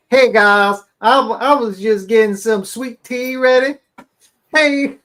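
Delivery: high, loud, sugary Southern greeting voice; sweet Southern accent. Retold or imitated. Mocking the streamer's fake Southern accent for her viewers. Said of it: "her sweet Southern accent".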